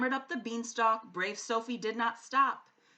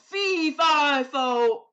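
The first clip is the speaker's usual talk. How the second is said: booming giant, louder; calling giant; 10 dB louder than usual. A narrator's reading. The giant greets Sophie at his door. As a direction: call out then grudgingly welcome her. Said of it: "The giant called".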